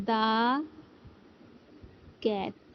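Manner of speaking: দা said as a short "duh" before cat; the before a consonant. Shown as a demonstration. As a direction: clip দা to a short a, then cat. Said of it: "কখন এবারে আমরা দা ব্যবহার করব". (ব্যঞ্জনবর্ণের আগে দা, অর্থাৎ ছোট "দা" উচ্চারণ)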